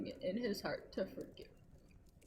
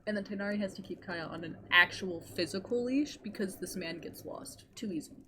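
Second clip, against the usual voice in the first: ordinary talk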